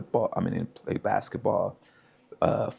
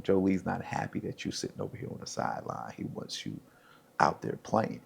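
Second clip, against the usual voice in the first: much softer, confidential aside; hushed retold coach; 10 dB quieter than usual. Coordinator to benched player on the sideline, hushed.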